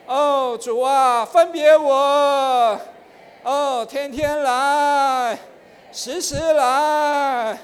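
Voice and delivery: pray-read the verse aloud with longing; slow fervent prayer voice; fervent prayer demo. Shown as a demonstration. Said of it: "比如说呢".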